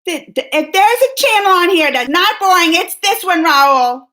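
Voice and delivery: loud, high, spluttering comeback; mock outrage. Vlogger defending her channel to Rahul, mock outraged. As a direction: playfully protest at the top of your voice.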